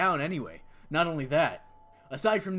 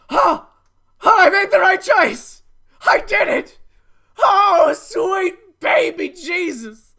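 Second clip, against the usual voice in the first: shrieking, much higher and louder joy; ecstatic relief; 12 semitones higher, 14 dB louder, slower than usual. Real, not acted. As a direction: scream with disbelieving delight. Relief at right choice.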